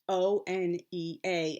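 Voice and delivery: spelled out slowly. Shown as a demonstration. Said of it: "I write them as".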